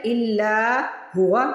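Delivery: इल्ला हुआ slow with हुआ drawn out; simple recited reading. Shown as a demonstration. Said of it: "पहले simple पढ़ लें". (सादा तिलावत, धीरे)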